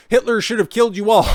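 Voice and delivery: high, loud hateful yell, hammed up; mock shout. Host mock-shouting the bigot's quote at viewers. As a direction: bark the quote like a ranting bigot.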